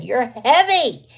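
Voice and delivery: high, loud, slow teasing; playful jab at a heavy husband; mock scolding. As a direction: tease him, drawn out and loud. Teasing husband about his weight.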